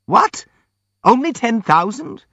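very high-pitched, squeaky disbelief; incredulous cry. A narrator's reading. Hippolyte hears the prince's offer, crying out incredulously. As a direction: cry out high, rising into a question. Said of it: "cried Hippolyte".

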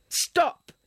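high, piercing cry; a desperate high shout of stop; sharp shout. Acted. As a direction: cut him off mid-sentence. She cuts off his accusation.

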